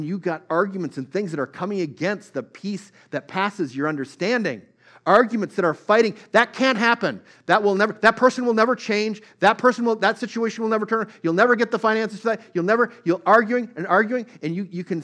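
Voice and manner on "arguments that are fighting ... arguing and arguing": raised, louder preaching build; fiery sermon climb; preaching at full force. Acted. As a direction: press it hard from the pulpit.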